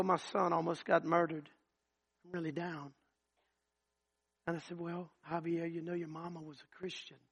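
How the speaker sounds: soft, hushed retelling of a grieving man. Retold or imitated. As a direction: drop almost to a murmur for the sad part. Quiet retelling of grief.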